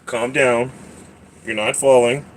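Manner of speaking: ordinary delivery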